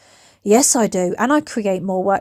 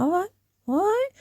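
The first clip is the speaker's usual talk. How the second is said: mock resigned; 9 semitones higher, 4 dB quieter than usual. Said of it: "I'm like".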